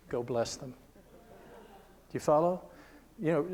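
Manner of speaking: normal talking voice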